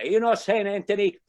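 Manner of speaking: put-on high, loud comic indignation, Italian accent; angry Italian accent. Italian guy fed up with the saint, mock-angry. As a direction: scold the saint as a hammed-up Italian. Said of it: "He gets angry at Saint Anthony".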